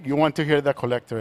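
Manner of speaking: ordinary delivery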